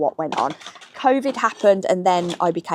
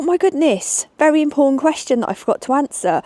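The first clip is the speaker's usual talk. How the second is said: mock realisation; 8 semitones higher, 4 dB louder than usual. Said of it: "I thought".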